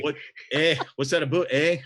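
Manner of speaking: mock the Canadian "a boot, eh"; Canadian accent. Joking about the cowgirls' speech, mock Canadian accent. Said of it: "She just said a boot".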